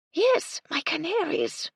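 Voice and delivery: very high, slow and fond; dotty old lady. Acted. Lady Belville telling the Colonel about her canaries, high and slow. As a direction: coo it over imaginary birds. Widow fondly names her birds.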